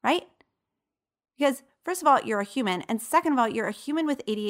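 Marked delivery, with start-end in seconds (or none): none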